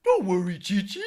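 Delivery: booming king reassuring his daughter. Acted. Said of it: "I can do the ox king".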